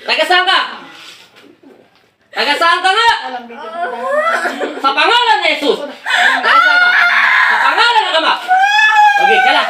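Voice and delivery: high, shouted interrogation and invocation; real heated exorcism; exorcist's forceful command. Real, not acted. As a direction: shout at the spirit to answer. Exorcist demands origin, invokes Jesus.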